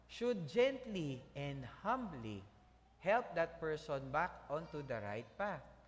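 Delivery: dahan-dahan at mahinahon na pagbasa; slow gentle reading. Shown as a demonstration. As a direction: read it slowly and gently. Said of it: "you need to humbly, gently". (mabagal at malumanay)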